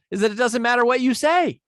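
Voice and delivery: high, loud and thrilled; loud and exciting. Shown as a demonstration. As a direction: burst out with excitement. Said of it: "this is loud and it's exciting".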